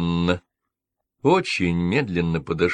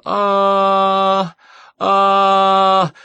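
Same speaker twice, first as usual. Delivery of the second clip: loud, high wailing "А, а!" while rocking; anguished scream; 10 semitones higher, 9 dB louder than usual. Acted. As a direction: let out a desperate cry. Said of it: "закричала". (отчаянный громкий крик)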